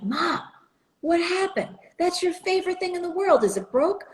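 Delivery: put-on high, dismayed child's question; rising disbelief at the broken radio; upset daughter asking mom, dismayed. Finding favorite radio dismantled.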